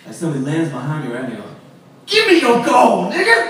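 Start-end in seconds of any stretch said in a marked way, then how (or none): 2.0-3.4 drawn-out, high, loud demand; mock loud demand. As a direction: imitate a stranger hollering an ambush. Acted stranger leaping up with demand.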